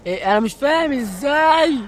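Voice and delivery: ordinary talk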